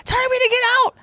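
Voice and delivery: high, loud yell imitating a frantic drunk woman; panicked yelling. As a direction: shout it like you're raising the whole building. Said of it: "pounding on everybody's door".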